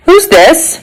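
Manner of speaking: high-pitched official query; inspector's demand. Acted. Inspector questioning a stranger.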